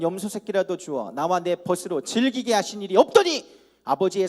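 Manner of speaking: loud, declaiming Bible reading; rising, forceful public reading of scripture. Acted. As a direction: proclaim the verses to the hall. Reads scripture aloud to congregation.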